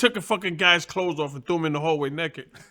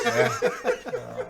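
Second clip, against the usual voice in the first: high laugh; 6 dB quieter than usual. Real, not acted. Big laugh.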